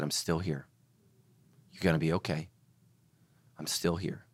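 low, soft comforting nurse; gentle reassurance. Retold or imitated. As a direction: soothe a frightened patient quietly. Retold nurse reassuring frightened patient.

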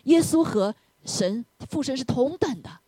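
usual speaking voice

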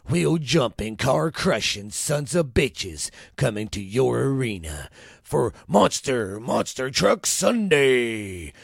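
booming monster truck announcer. Acted. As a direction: hype it like a monster truck commercial. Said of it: "part of the show was brought to you today by".

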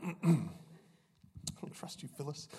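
very soft, voiceless whisper of a sitcom line; hushed, playful aside; whispered quote. Acted. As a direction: whisper the joke accusation under your breath. Repeats the sitcom quote under breath.

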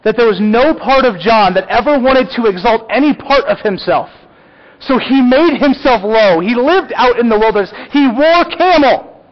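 high, loud, emphatic preacher's proclamation; impassioned preaching. Real, not acted. Pastor exalting John's humility to the congregation, impassioned preaching. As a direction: preach it at full force, pitched high.

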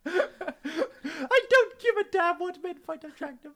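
high hammed-up girlfriend; skit woman. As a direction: rave about pot bellies.

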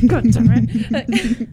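slow, loud, laughing curse; laughing exasperation. Real, not acted. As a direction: curse through real laughter. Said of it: "I'm so annoyed".

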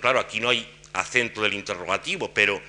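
ordinary talk, nothing marked

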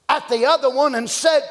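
usual speaking voice

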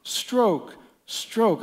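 high yelled stroke calls; barked rowing orders. Retold or imitated. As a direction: call the strokes like a rafting guide. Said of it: "he would yell".

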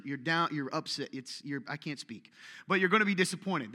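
ordinary talk

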